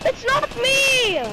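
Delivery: high-pitched cry of denial; indignant protest. Real, not acted. Blamed player answering his teammates, indignant protest. Denies blame in game chat.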